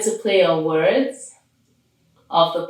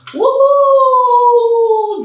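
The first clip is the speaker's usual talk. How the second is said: high, loud cheer; excited whoop; 9 dB louder than usual. Real, not acted. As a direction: throw out a big happy woohoo. Host cheers at show opening.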